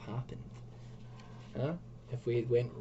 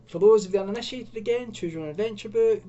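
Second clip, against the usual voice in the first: excited; 9 semitones higher, 8 dB louder, faster than usual. Real, not acted. Hyped fast explanation.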